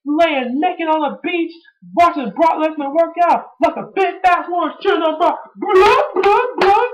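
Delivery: high, whiny mocking impression of Paul Heyman. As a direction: squeal it like a crying fan-boy manager. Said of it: "Paul Heyman was like".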